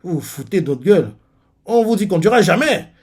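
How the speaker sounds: angry outburst. Retold or imitated. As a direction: snap it back at them. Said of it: "on voit Shin qui fait".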